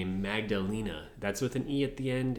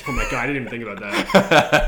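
loud, quick, real laughter through the words; laughing; 3 semitones higher, 11 dB louder, faster than usual. Real, not acted. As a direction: crack up while blurting it out. Bursts out laughing at accidental innuendo.

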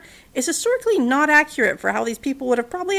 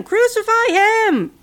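much higher, louder, slow shout; shouting louder still; 10 semitones higher, 6 dB louder, slower than usual. A narrator's reading. Mob's chant peaking at Pilate, high slow shout. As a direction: peak the chant, stretching it out. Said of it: "They shouted louder".

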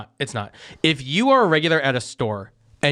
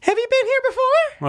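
put-on very high, loud squeaky girl voice; shop girl impression; 4 dB louder than usual. Impression of the young employee greeting customers, very high and squeaky. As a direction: squeak it out like the cashier. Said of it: "best impression of her".

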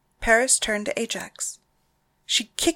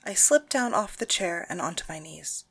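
plain reading voice

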